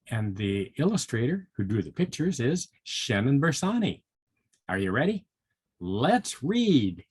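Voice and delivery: ordinary delivery, nothing marked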